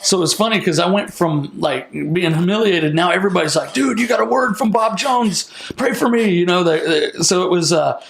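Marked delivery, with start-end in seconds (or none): 3.7-6.3 higher, mock-excited crowd voice; played-up eager pleading; eager admirers. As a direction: imitate fans clamoring for a favor.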